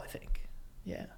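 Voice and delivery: whispered hesitant aside. Real, not acted. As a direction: drop to a breathy whisper. Unvoiced aside.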